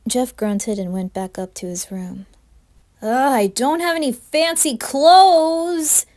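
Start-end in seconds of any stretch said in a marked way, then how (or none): 3.0-6.0 much higher, loud, slow whiny yell; yelled complaint. Acted. Jeff yelling downstairs to his parents, loud whiny complaint. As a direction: yell it down the stairs, groaning. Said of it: "he yelled downstairs".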